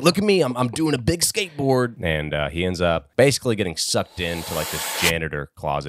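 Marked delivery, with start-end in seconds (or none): 0.0-2.0 put-on show-off kid. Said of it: "he's like".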